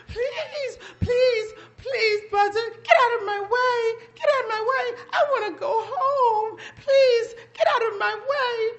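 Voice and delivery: high, pleading whimper of a scared rabbit. Acted. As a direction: beg tearfully in a high voice. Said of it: "He said".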